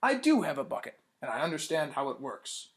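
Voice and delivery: plain reading voice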